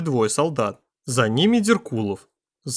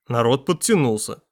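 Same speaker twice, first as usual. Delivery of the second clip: ordinary delivery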